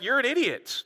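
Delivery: high-pitched scornful put-down; dismissive mock retort. Leaders refusing to debate with soldiers, dismissive.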